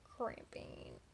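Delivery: whispered, pained aside. Real, not acted. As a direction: breathe it out in pain.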